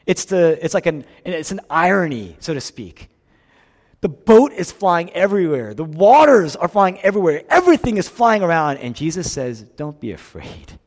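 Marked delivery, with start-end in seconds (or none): 4.0-8.8 high, loud, mounting preaching; urgent storm description. Retold or imitated. Preacher painting the storm at sea, loud urgent voice. 9.6-10.8 soft, low, poised reassurance; calm, unrattled. Acted. Jesus to the terrified men in the boat, calm and unrattled. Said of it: "Completely unrattled. Tremendous poise".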